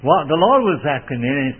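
ordinary talk

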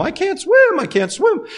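hammed-up panic, voice leaping up; mock panic cry.

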